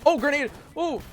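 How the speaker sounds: high, loud grenade warning; alarmed shout. Real, not acted. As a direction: yelp the warning suddenly.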